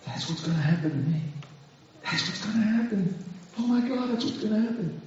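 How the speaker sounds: hushed, fretful inner voice; acted dread. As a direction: softly act out worried thoughts spiralling. Acts out fearful thoughts.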